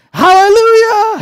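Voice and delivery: whooped high and very loud, in delight; a joyful holler at full voice; exuberant shout. Acted. Joke's rider cheering the donkey on, exuberant shout.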